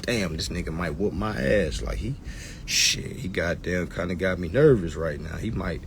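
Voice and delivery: low, soft nervous mutter; scared inner thought. Retold or imitated. Street guy quietly admitting he's nervous. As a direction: mutter like you're spooked by a tough guy.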